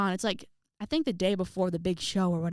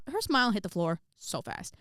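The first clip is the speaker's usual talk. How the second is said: ordinary talk, nothing marked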